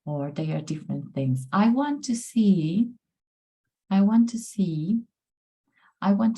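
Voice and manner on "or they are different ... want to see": ordinary talk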